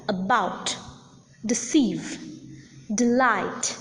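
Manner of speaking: aBOUT, deCEIVE, deLIGHT; second-syllable stress. Shown as a demonstration. Teacher models how to pronounce about, deceive, delight, second syllable stressed. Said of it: "we need to articulate or pronounce the words".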